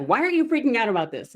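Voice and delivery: high, loud and fast disbelief; incredulous husband. Retold or imitated. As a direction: rush it like a stunned husband. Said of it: "He's like".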